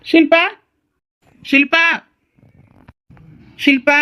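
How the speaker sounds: short searching calls; calling out. Acted. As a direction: call into the house for her. Husband keeps calling for wife.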